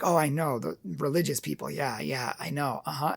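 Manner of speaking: low, soft, going-along murmur; just humor her quietly, half-hearted; mumbled yessing. Retold or imitated. To the girl venting about religion, soft mumbled agreement.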